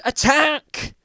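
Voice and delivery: huge yell of attack; shouted command. Acted. Mike yelling at the descendants to attack. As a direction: bellow the order to charge. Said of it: "Mike shouted".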